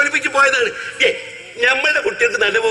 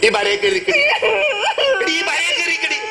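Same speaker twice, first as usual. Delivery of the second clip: pleading shout; 10 semitones higher than usual. Acted. Husband begs her to stay.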